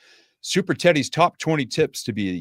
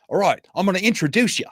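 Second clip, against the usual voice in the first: higher-pitched Australian show host impression; Australian host's accent; 9 semitones higher, 3 dB louder than usual. Australian host briefing a guest on his five-minute show, Australian accent. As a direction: rattle off the plan in an Australian accent. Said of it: "I got to hear his accent".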